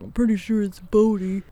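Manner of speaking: cocky, sure-of-itself self-quote; mock confident. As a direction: play your overconfident past self. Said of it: "was like".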